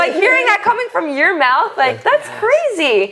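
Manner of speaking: high-pitched retold disbelief, stretching out the end; amazed. Shoe seller retelling her reaction to an organizer's praise, amazed.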